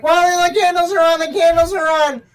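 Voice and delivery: high, loud frantic retelling; mock panic about the candles; panicked friend. Friend's panicked texts voiced.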